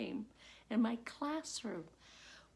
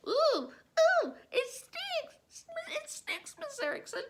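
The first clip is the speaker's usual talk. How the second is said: put-on high, loud wailing child voice; grossed-out children whining high to their teacher; whiny kids crying; 6 dB louder than usual. Kids wailing about the smell.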